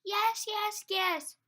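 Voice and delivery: high, loud, tearful hippo cry; cried out. A narrator's reading. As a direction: wail it out in distress. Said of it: "she cried".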